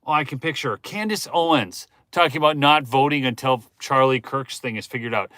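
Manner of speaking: normal talking voice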